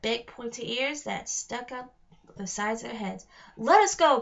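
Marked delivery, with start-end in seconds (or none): 3.6-4.2 loud, forceful demand. Acted. Connor in the net ordering the goblin and troll, demanding loudly. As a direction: order them, full voice. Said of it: "Connor demanded".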